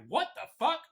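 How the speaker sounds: high, loud re-enacted shock; startled disbelief. Retelling his reaction to the whispers, loud startled disbelief. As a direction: blurt it out in alarmed disbelief.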